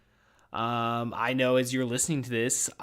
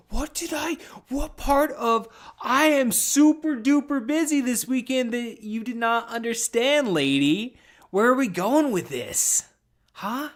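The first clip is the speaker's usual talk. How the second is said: higher, slowed-down mock disbelief at his wife; exasperated retort; 8 semitones higher, 4 dB louder, slower than usual. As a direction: ham up the outraged husband.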